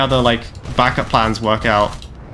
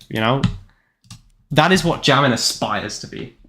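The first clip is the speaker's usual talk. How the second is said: usual speaking voice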